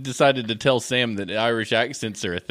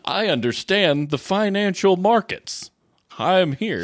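slow, raised-pitch pompous proclamation; mock grand entrance; 6 semitones higher, 3 dB louder, slower than usual. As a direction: declare it like a self-important money expert. Joins the mock Jacob bit.

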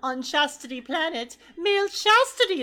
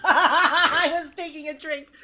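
laughing; 4 dB louder than usual. Real, not acted. Laughing at the joke mid-drink.

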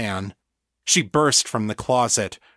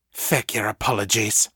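growled. A narrator's reading. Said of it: "Mother growled".

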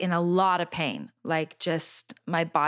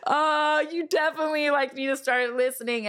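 laughing; 8 semitones higher than usual. Retold or imitated. Laughing at God's repeated request.

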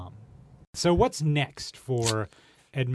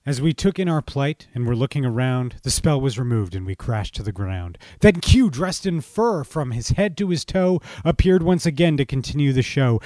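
rhyming verse read with lively lilt; storyteller reading festive rhyme; poem recital; 5 dB louder than usual. A narrator's reading. Narrates verse.

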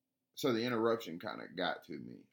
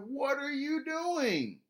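high, slow disbelieving question; incredulous; 4 dB louder, much slower than usual. Retold or imitated. Retelling his stunned reply to the stranger, slow and incredulous. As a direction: stretch it out in bewilderment. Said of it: "And I'm like".

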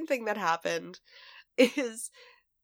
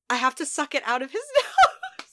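higher, louder, breaking into laughter; laughing; 7 semitones higher, 5 dB louder than usual. Real, not acted. Mother confessing a gross fix to her co-host, laughing. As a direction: laugh through the confession, rising at the end.

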